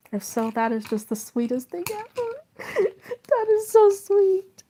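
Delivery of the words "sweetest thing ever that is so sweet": high-pitched, loud gushing with laughter breaking in; gush, moved and delighted, voice climbing high; happy emotional. Real, not acted. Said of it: "I get really emotional ... happy emotional".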